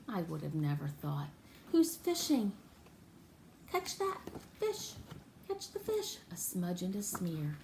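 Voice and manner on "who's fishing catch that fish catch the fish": much higher, light and sing-song; playful high voice. Acted. Urging the fisherman for Nat, playful high voice. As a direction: cheer the fisherman on.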